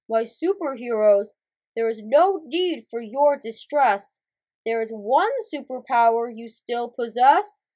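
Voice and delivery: low, measured mentor voice; grandly steady the worried heroes; Big Boss figure. Acted. Big Boss reassures his superheroes, low and grand.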